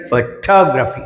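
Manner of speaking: Photography with a weak opening and strong second syllable TOG; stress on second syllable. Shown as a demonstration. Teacher modelling for students, second syllable of photography stressed. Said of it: "అని పలకాల".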